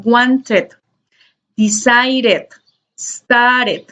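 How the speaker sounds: WANTED, DECIDED, STARTED with a full extra "ed" syllable; added final syllable. Shown as a demonstration. Teacher models the third ending, extra syllable on wanted, decided, started. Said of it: "como si añadiéramos una sílaba al final".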